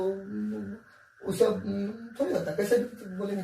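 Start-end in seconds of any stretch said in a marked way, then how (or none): none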